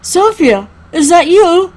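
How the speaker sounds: calling out. Acted. Rescuer searching for Sophia in rubble, urgent call. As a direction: call out to find someone. Rescuer calls to buried narrator.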